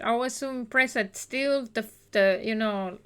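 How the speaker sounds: normal talking voice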